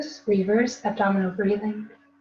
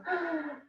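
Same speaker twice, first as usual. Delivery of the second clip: sharp frightened intake of breath; scared gasp; 6 semitones higher, 5 dB quieter than usual. Shown as a demonstration. Teacher demonstrating a scared gasp for breathing. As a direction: gasp in like you're startled. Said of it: "imagine you're scared and you go".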